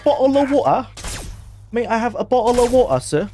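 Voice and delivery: high-pitched mock British accent; badly faked London accent. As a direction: ham it up like an American faking a London accent. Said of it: "Americans to do the accents".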